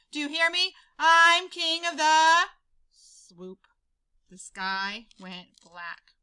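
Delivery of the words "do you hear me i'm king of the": loud and slow, bragging to the crowd; boastful shout. Acted. Big Bark bragging to the prairie dogs, slow loud shout. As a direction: roar your boast and stretch it out.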